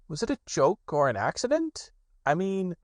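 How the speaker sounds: lightly voiced bear; Pooh voice. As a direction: Pooh puzzling it out. Pooh asks.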